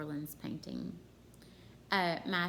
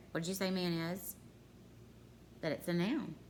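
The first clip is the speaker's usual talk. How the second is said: ordinary talk, nothing marked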